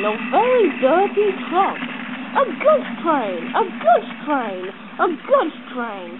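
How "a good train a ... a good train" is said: grumbling. A narrator's reading. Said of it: "he grumbled".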